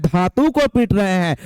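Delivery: ordinary talk, nothing marked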